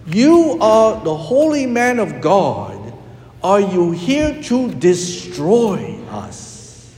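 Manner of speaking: high start, slowing into a fearful question; fearful demons. Retold or imitated. Priest voicing the demons' real cry, afraid. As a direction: let the demon fear show, slowly. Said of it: "The demons said".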